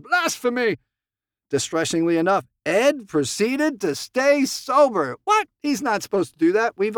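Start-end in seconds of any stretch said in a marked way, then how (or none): 0.0-0.8 mock outrage. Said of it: "it was thought".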